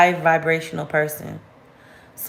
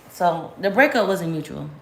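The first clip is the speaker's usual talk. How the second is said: usual speaking voice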